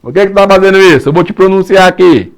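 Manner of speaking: a judge yelling at the defendant; stern judge scolding. Acted. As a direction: play the judge dressing down the accused, loud. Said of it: "Aí o juiz vai falar pra ele".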